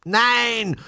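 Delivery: high, loud refusal; childish outburst of anger; angry boy's shout. A narrator's reading. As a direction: yell it like a furious little boy. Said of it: "schrie ein stupsnäsiger Junge zornig".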